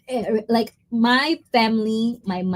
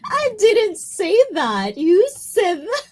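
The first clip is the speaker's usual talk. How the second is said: playful denial; 12 semitones higher than usual. Real, not acted.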